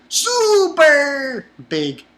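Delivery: high, loud, very slow drawn-out cry; high, loud, slow bawled super big; Franky voice. Retold or imitated. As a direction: stretch it out like a tearful showman. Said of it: "He was like".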